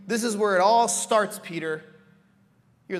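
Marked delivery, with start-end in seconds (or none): none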